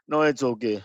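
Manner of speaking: imitated low, soft brush-off; dismissive tone. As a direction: wave it off quietly, mimicking indifference. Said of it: "his face and tone was".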